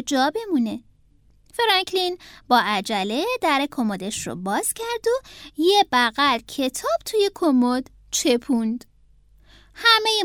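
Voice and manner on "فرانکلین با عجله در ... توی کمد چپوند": plain reading voice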